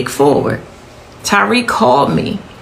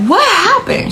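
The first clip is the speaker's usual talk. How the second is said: frantic. Retold or imitated. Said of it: "I'm, I'm frantic".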